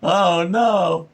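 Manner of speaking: voiced character gasping in alarm; shocked oh no. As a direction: act out the drawn guy's shock. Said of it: "He's like".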